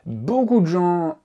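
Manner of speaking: beaucoup stretched high and loud; drawn-out emphatic beaucoup; emphatic stress. Shown as a demonstration. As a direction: lean hard on beaucoup, slowly. Teacher stressing a point.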